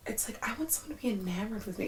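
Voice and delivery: soft, fast whisper; whispered wish. Real, not acted. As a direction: whisper the longing.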